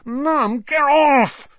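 put-on high, loud boyish whine; whiny protest. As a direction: squirm away protesting. Boy squirms from mother.

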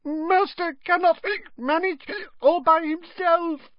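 very high, hiccuping wail; tipsy, distressed house-elf voice; whimpering elf. A narrator's reading. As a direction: fret for your master in a tiny squeak. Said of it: "whimpered the elf".

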